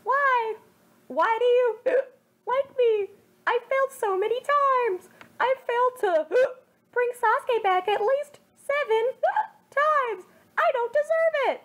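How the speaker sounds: high, gasping sobs between words; a boy sobbing that he doesn't deserve love. Acted. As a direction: cry it out, catching breath mid-sentence. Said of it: "Naruto hiccuped and sobbed into Kiba".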